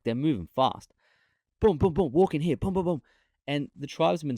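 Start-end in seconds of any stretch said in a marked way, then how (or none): 1.6-3.0 loud, higher, punchy booms like hurried strides; mock footsteps. Copying Westerners striding fast, loud booming footsteps. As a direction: stomp it out like rushing feet.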